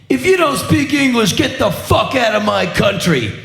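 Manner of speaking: high-pitched mock rock-star yell. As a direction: bellow it at the crowd from the stage. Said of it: "he yells out".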